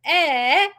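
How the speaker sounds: high, loud imitated "eh"; playful wordless mock yell; mocking toddler shout. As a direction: mimic a baby copying mom's holler. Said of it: "She would mock me and be like".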